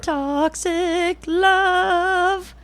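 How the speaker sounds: very slow, high dramatic line; drawn-out mock romantic. Wife capping the joke about the couple, slow and high. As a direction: stretch it out like a melodrama title.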